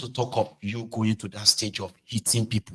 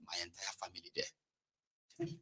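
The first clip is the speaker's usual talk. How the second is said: regretful; 4 semitones lower, 23 dB quieter, slower than usual. Retold or imitated. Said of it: "filled with regret".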